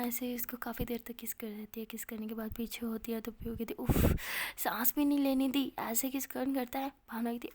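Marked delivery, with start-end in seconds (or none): 3.7-6.9 loud huff, then complaint; acted exasperation, easing off; flustered protest. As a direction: protest as if robbed of air. Protests after a long kiss.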